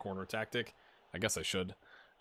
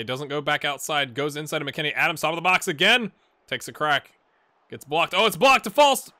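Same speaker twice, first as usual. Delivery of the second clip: louder, higher excited commentary; play-by-play commentary; 5 semitones higher, 9 dB louder than usual. Acted. Gamer calling the US team's highlights, excited play-by-play. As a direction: ride the rhythm of each pass.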